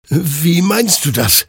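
stammering confused. Acted. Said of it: "stammelte Hektor verwirrt".

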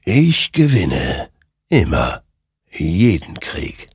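slow, eerie, otherworldly final word; ominous spirit. A narrator's reading. Rider tells Hektor he always wins, slow and eerie. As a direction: let the line hang like death itself.

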